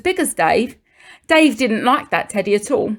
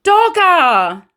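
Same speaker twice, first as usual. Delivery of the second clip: sudden higher, louder cry of the name; excited discovery; 8 semitones higher, 4 dB louder than usual. A narrator's reading. Narrator reading Dave finding his lost toy, excited. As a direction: burst out with joyful surprise.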